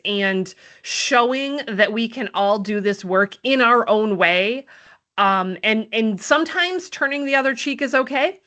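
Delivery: normal talking voice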